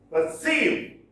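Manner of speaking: "Perceive" with the end high; teacher's end-stressed "Perceive", pitch lifted; stress on second syllable. Shown as a demonstration. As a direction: punch "CEIVE" in "Perceive". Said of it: "Second syllable stressed".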